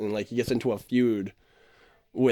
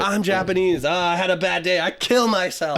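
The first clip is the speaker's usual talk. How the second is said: higher, louder put-on cartoon accent, slowing at end; mock Japanese character; 5 semitones higher, 4 dB louder than usual. Retelling the South Park joke, friend hams a mock accent. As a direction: parody a melodramatic cartoon character. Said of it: "he's always like".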